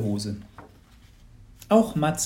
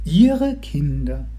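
a disguised neighbour laying on the cheer; hammed-up gushing congratulations; gushing lottery lady; 3 semitones higher, 6 dB louder, much slower than usual.

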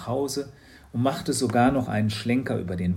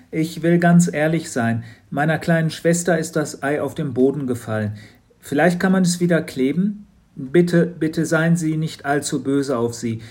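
put-on louder, pleading boy's voice; Matz, nervous boy; 5 semitones higher, 9 dB louder than usual. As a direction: rush the apology and get out. Matz nervously hands back egg. (nervöser Junge, hastig bittend)

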